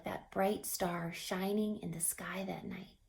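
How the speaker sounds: soft awed whisper. A narrator's reading. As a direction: breathe it like a secret. Soft wonder at the star.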